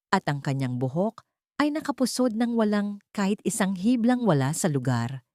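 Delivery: ordinary delivery, nothing marked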